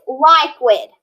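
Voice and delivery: "liquid" slowly as LIKE-wid; long I at the start of "liquid"; decoded mispronunciation. Shown as a demonstration. Teacher decoding by rule for pupils, mispronounced "liquid". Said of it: "No, we drink".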